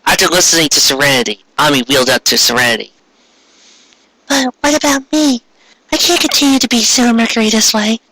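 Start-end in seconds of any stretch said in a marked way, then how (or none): 4.3-8.0 put-on high, worried girlish plea; high Ami voice. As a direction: plead in a small high voice. Ami pleading to Serenity.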